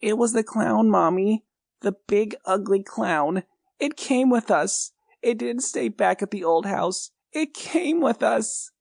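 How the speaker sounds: put-on high child's voice, frightened and pleading; a small boy telling his mom about the clown; scared little boy. A narrator's reading.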